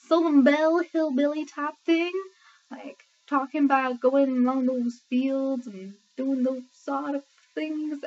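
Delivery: slow, mock Southern belle accent; Southern hillbilly accent. Shown as a demonstration. Brit showing where her American accent ends up, Southern drawl. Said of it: "end up somewhere in the South".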